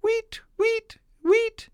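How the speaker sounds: very high chirping linnet; bird twitter. A narrator's reading. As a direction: tweet it high and quick like tiny birds. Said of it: "twittered the green linnets".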